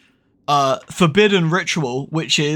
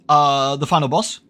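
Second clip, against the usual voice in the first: ordinary delivery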